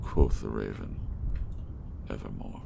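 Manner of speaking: theatrical recital with swelling passion and hushed ends; dramatic poem reading. Acted. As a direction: recite it like a stage performance. Reads The Raven aloud.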